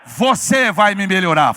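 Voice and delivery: loud call-out to the congregation; big, loud chant-leading shout; rallying shout. Shown as a demonstration. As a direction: shout it out for everyone to repeat. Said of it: "dá um grito aí e fala bem alto".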